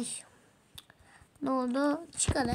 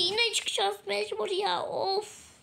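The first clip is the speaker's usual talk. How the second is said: higher whining complaint, ending in a sigh; whiny frustration; 7 semitones higher, slower than usual. Real, not acted. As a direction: moan it like you're about to cry. Forced to quit again. (ağlamaklı sızlanma)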